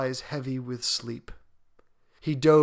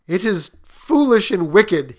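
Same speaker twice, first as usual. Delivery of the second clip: loud, troubled protest, pitched up; uneasy, agitated old man; faltering; 6 semitones higher, 10 dB louder than usual. A narrator's reading. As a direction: object haltingly, in dread. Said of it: "he faltered".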